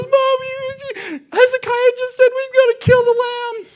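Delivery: very high, loud hammed-up child wail; crying little kid. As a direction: wail to Mommy like a small child. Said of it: "little kid starts crying and goes to Mommy".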